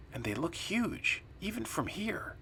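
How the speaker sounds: soft, high, whispery undertone; hushed awe. Acted. Friends spy on huge strangers by the river, hushed. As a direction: drop toward a whisper as you point them out.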